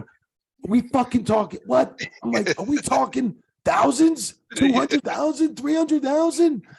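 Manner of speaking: very high, slow squeaky voice of shock; stunned disbelief. Retold or imitated. Referee hearing the wrestlers' pay, very high slow shocked squeak. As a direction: squeak out the numbers in total disbelief.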